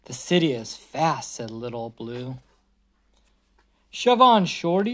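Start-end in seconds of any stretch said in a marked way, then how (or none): 3.9-4.9 higher, louder yell. Acted. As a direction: bark it like a pushy bus. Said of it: "yelled a double-decker bus".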